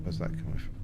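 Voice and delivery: soft whisper to himself; whispered aside. Real, not acted. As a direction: breathe the question under your breath. Muttering to himself.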